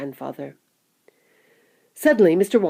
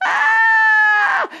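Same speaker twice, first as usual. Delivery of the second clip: long high squeal of excitement; excited scream; 7 dB louder than usual. A narrator's reading. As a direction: scream like thrilled passengers. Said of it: "screamed with excitement".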